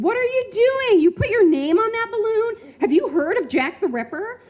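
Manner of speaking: put-on high, loud frantic shriek at her child; retold screaming. Mom chasing daughter outside, high panicked scream. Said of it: "chased out behind me screaming".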